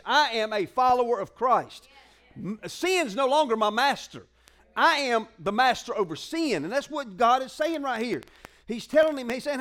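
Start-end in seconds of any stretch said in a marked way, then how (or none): none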